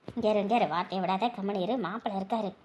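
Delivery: low, very soft shushing; discreet, low-pitched caution; hushed warning. Acted. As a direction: quietly rein them in so others don't hear. Hushing relative near the son-in-law.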